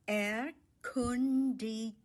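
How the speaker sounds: "Erkundigen" drawn out slowly, each syllable set apart. Shown as a demonstration. Teacher breaking down the German word for explore, syllable by syllable. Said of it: "The stress is on the second syllable".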